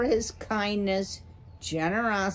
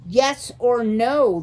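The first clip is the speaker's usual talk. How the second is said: loud, higher burst of impatience; screamed demand; 5 semitones higher, 8 dB louder than usual. A narrator's reading. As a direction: shout over the other speaker. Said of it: "the owl screamed".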